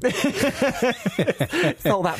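high, slow, laughing through it. Real, not acted. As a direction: giggle the words out.

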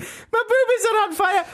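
shrieking high Scottish falsetto; hammed-up panicking Scottish nanny; Mrs. Doubtfire. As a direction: squeal it in grotesque Scottish. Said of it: "Robin Williams in Missus Doubtfire".

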